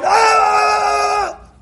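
long, very high held scream; imitated scream. Husband home late from work, screaming at his wife. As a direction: mimic a man screaming at home. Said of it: "He starts screaming at his wife".

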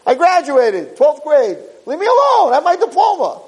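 high, fed-up, whining voice; whiny complaint. Acted. Avram complaining about his tests, high whining. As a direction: beg to be left alone.

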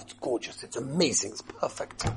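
awed whisper. Retold or imitated. Awed at the fitted suit.

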